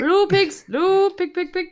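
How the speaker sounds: high, chanted pig call; pig-calling dialect. Acted. Imitating the local man calling pigs, high dialect call. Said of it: "that's what he calls pigs".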